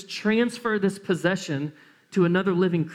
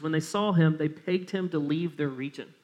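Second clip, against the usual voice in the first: ordinary talk, nothing marked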